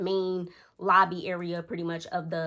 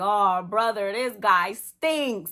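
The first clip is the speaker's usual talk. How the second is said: slow drawn-out exasperated meme voice; louder mock exasperation; SpongeBob quote; 7 dB louder, much slower than usual. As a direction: groan it like the cartoon. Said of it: "Y'all know the SpongeBob".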